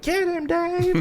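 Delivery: high falsetto crowd cheer; mock crowd cheer for the coach. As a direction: cheer it like fans egging on the coach. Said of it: "all the crowd's like".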